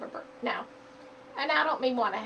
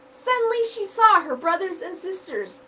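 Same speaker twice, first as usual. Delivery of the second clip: lively storybook narration, higher and louder; reading to children; 5 semitones higher, 10 dB louder than usual. Acted. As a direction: read it aloud like a picture book for kids. Reads picture book aloud.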